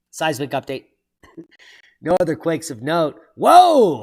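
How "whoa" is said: sudden loud high whoa; startled whoa. Real, not acted. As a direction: blurt it out, caught off guard. Sees big quake number.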